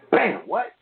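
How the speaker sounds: high-pitched startled "what?"; retold shock. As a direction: react to sudden bad news, voice jumping up. Retold shock at bad news.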